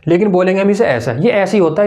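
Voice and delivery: ordinary talk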